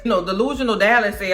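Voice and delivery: usual speaking voice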